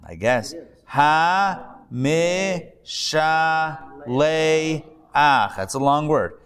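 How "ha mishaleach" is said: "Hamishaleach" sounded out very slowly; "Hamishaleach" drawn out one syllable at a time. Shown as a demonstration. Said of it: "you go eh on that one".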